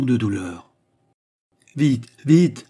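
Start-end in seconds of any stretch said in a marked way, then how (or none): 1.8-2.7 loud, higher urgent command; urgent order. A narrator's reading. Duke ordering servants about, loud and urgent. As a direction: bark it out to the servants. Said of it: "ordonna-t-il".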